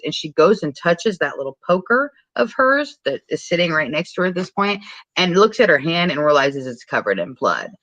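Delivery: ordinary delivery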